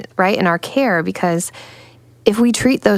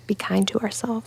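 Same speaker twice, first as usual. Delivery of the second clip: whisper; 4 semitones higher, 8 dB quieter than usual. Real, not acted. Gentle closing reminder.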